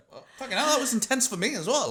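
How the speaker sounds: high, rattled mock protest; mock shaken protest. Actor to the crew, high mock shaken protest. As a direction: squeak it out like you are shaken. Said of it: "standing there going".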